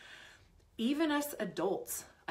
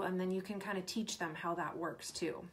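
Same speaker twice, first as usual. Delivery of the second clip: ordinary delivery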